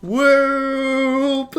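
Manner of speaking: loud, high drawn-out whoop; high held cry of triumph; whooping cheer. Acted. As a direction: let out one long celebratory whoop. Character cheers in the game.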